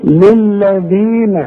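للذين with the long ii held two counts, slow; natural madd. Shown as a demonstration. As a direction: draw out the ya in للذين. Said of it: "شوف". (مد الياء في للذين حركتين)

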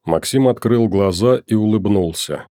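usual speaking voice

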